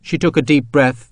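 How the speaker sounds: ordinary delivery, nothing marked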